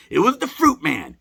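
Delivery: normal talking voice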